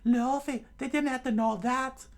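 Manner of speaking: loud, very high falsetto scolding; angry Nami. A narrator's reading. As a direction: scold in an exasperated put-on girl's voice. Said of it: "in pure anger".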